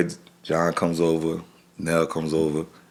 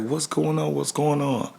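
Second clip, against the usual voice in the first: alarmed questions; 9 semitones higher than usual. Retold or imitated. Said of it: "'cause I'm screaming".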